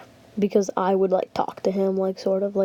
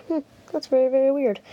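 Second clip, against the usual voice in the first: higher imitated teacher musing; suspicious teacher; 6 semitones higher, much faster than usual. Teacher finds the long absence weird, higher puzzled voice. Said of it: "getting suspicious".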